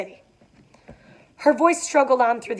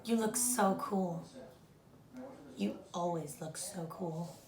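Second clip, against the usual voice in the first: much softer, slow intimate murmur; soft lovestruck; 2 semitones lower, 14 dB quieter, slower than usual. Shown as a demonstration. Teacher rereading Daisy to Gatsby, soft and slow. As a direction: breathe it to him, slow and tender. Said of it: "we're gonna take a look at that".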